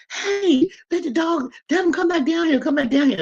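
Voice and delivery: high yelling call to the dog; hollering. Retold or imitated. Calling across the yard to husband, high yell. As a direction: call the dog back from far off. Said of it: "And I'm hollering".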